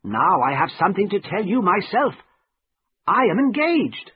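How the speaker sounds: high, emphatic character voice; friend Herbert, announcing news. A narrator's reading.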